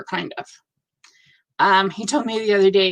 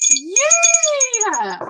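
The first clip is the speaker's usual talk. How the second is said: high squealed cheer, much higher; excited yay; 13 semitones higher than usual. Real, not acted. Cheering a viewer's finished cross stitch, high excited squeal.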